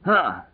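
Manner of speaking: high, short effortful huh; exerted grunt. A narrator's reading. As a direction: grunt as if swinging a club. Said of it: "Lloyd grunted".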